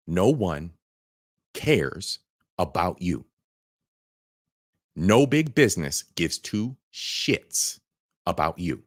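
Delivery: patronizingly slow. Real, not acted. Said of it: "I'm gonna say it again for the eighth graders".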